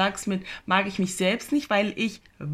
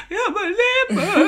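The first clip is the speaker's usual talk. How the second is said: much higher, laughing fake wail; a hammed-up whimper, pitched way up; mock self-pity; 13 semitones higher, 4 dB louder than usual. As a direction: play the crybaby, half laughing. Sitting in the corner lamenting.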